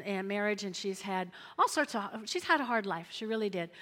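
normal talking voice

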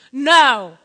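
loud, drawn-out "no"; retold emphatic refusal; firm refusal. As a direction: shut him down hard. Wife refusing.

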